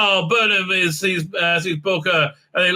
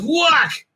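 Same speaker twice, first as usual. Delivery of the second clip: high, punchy mock fight "whack"; cartoon blow effect; shouted whack sound; 9 semitones higher than usual. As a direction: call out the hit, pitched way up. Acts out the blow.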